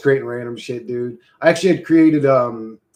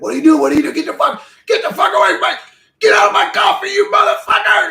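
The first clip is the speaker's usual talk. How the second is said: high, loud, frantic yelling; mock outraged shouting; 10 dB louder, faster than usual. Coffee drinker chasing off little zombie man, mock furious screaming. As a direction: shriek at a pest in hammed-up outrage.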